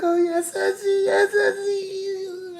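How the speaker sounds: very high, hammed-up wail; put-on high sobbing voice; imitated crying. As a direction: squeal tearfully, mimicking her. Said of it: "legitimate tears started coming down".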